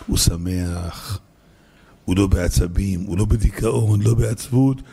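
low, unhurried and settled; calm serenity. Shown as a demonstration. Preacher describing the believer at peace, calm low voice.

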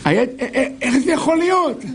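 high, laughing disbelief; hammed-up astonished father; amazed.

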